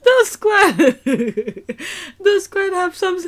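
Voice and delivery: words broken by real laughter, slow; laughing too hard to get it out. Real, not acted. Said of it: "I'm laughing".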